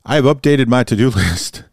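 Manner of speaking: laughing nervously mid-sentence; embarrassed chuckle through the words. Real, not acted. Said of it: "That's nervous laughter".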